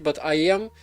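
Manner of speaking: ordinary talk, nothing marked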